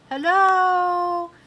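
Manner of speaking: high, loud calling shout; shouted hello. Acted. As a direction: call it out across the mountain. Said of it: "and I shouted".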